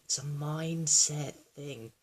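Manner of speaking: MINDSET stretched out slowly; slow it right down to drive the point home; slow, drawn-out emphasis. Real, not acted. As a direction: draw out "mindset thing" at half speed. Repeats phrase for emphasis.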